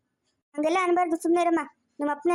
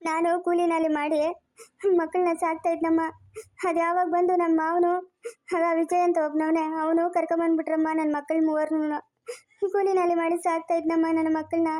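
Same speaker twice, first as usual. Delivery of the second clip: crying while recounting hardship; tearful lament. Acted. Labourer father tells how he raised his children, crying. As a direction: tell it through sobs. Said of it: "ನೀನ್ ಅಳ್ಬೇಡ ಸುಮ್ನಿರು".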